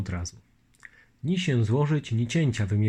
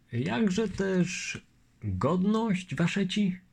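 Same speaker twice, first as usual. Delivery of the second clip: raised, mild character voice; a hint of the polite Lithuanian; Litwin's voice; 8 semitones higher, much slower than usual. Longinus asking the lieutenant his name, lightly voiced.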